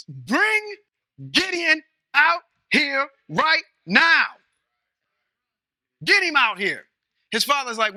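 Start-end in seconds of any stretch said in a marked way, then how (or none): 0.1-6.9 mob yelling. Retold or imitated. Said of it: "angry townsmen".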